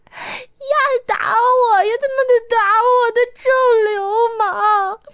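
high, loud broken crying; incoherent sobbing. A narrator's reading. Beaten Ge Yiqing sobbing to Chongwen on the phone, incoherent. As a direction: blurt it out between sobs. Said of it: "语无伦次地说".

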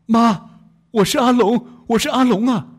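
high, loud anguished cry of Mom; calling out loudly. Acted. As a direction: call out to mother in distress. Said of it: "大声地喊道".

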